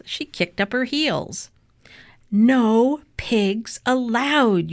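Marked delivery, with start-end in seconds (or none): 2.3-4.7 slow, high, drawn-out shout; emphatic shout, word by word. A narrator's reading. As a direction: declare it as a stretched storybook yell. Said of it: "Eugenia shouted".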